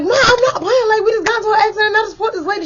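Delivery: high, loud and cracking, close to crying; near tears, pleading. Retold or imitated. Daughter insists to her doubting mother, half crying. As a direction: beg her to believe you, voice breaking. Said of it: "And I'm like".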